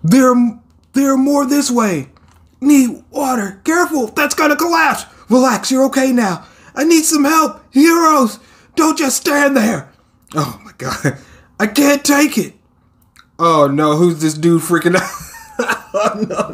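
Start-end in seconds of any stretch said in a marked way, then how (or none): 0.0-9.9 high, urgent voiced rescue calls; frantic rescuers. Rescuers shouting orders at the disaster site, high urgent calls. 11.6-12.5 high, strained voice of a man at his limit; overwhelmed hero. Acted. Hero breaking down at the disaster scene, high strained cry.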